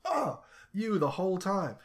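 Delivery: high, loud indignant realization; realizing outrage. Retold or imitated. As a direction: catch the real culprit, outraged. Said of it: "It's like".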